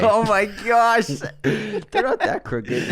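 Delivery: laughing protest. Real, not acted. Reacting to teeth roast.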